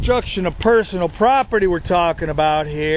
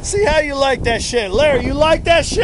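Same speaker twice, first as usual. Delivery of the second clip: louder, higher taunt, punch on see; 7 semitones higher, 5 dB louder than usual. Acted. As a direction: hit see hard and sneer. Taunting again, harder.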